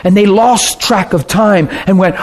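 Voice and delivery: ordinary talk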